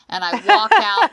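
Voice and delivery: words breaking into laughter; laughing. Real, not acted. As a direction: laugh through the line. Laughing while retelling dive.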